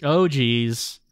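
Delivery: ordinary talk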